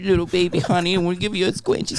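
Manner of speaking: very high squeaky pet talk; silly dumb pet voice. Retold or imitated. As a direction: coo it high like to a puppy. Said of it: "I'm talking to, like, my dog".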